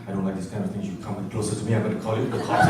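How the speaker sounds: cold rebuff. Retold or imitated. Woman rebuffs a stranger.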